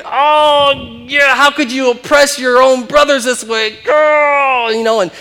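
high, loud mock-outraged shouting. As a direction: cry out in hammed-up indignation. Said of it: "he's all outraged".

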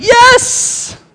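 yelled high with triumph; triumphant yes. Retold or imitated. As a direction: cheer the win. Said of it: "I was like".

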